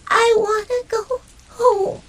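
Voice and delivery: whimpering. Acted. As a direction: whine it like a scared puppy. Scared puppy in storm.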